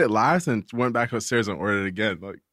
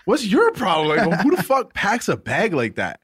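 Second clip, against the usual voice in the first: higher-pitched, exasperated challenge; indignant confrontation; 5 semitones higher than usual. Retold or imitated. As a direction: act out calling someone out. Retelling his angry confrontation.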